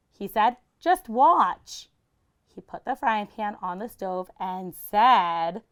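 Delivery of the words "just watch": cocky, high and bright; confident boast. Acted. Herschel boasting to his brothers, cocky. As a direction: show off to the boys.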